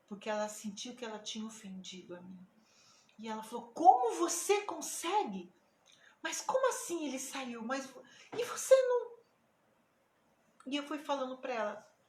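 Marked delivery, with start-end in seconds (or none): 3.7-9.2 loud, high incredulous questions; retold stunned disbelief, rising high at the end; acted-out astonishment. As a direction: re-enact someone who cannot believe it. Acts out the mother's disbelief.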